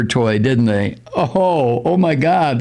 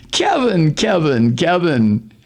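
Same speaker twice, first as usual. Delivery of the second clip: mock scolding; 5 semitones higher, slower than usual. Chiding Kevin.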